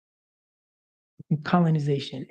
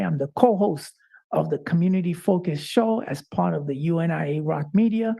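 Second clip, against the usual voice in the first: ordinary talk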